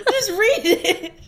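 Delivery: slow, high and loud through laughter; slowed, giggly order to just read; laughing plea. Real, not acted. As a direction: push the words out while laughing. Begs cohost to move on.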